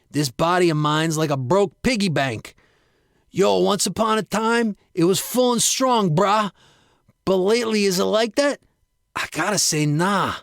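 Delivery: a pumped-up bro griping about his body; Frank's bro voice. Acted. Frank to his pal, griping in a brash bro voice.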